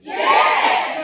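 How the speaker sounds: very high shouted "Yes!". Retold or imitated. Recruit yelling on command at a sales meeting, shouted. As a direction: scream "Yes!" like a hyped recruit. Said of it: "sigaw daw ako ng".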